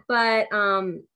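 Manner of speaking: ordinary delivery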